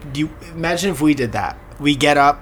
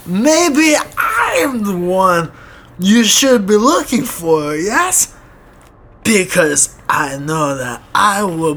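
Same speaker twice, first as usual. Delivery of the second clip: higher, slow, hammed-up Russian menace, rising to shouts; sketchy Russian accent; 6 semitones higher, 4 dB louder, much slower than usual. Russian neighbor taunts him in his imagination, in a sketchy Russian accent. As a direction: spin the threat out slowly in a sketchy Russian voice. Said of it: "He has a real sketchy vo-, scratchy voice".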